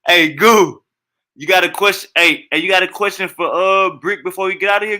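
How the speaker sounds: host hailing a caller on stream, loud call. Real, not acted. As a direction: call out to the caller to get his attention.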